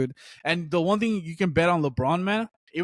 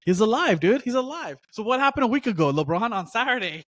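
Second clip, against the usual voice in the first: worked up ribbing; 7 semitones higher than usual. Real, not acted. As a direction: pitch it up, teasing a friend who's suddenly online. Ribbing LeBron about his Instagram.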